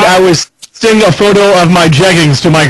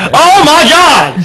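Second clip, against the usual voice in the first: drawn-out, high-pitched exclamation of disbelief; incredulous, higher and slower; amused disbelief; 8 semitones higher, much slower than usual. Real, not acted. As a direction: stretch it out high, astonished. Mock shock at touche remark.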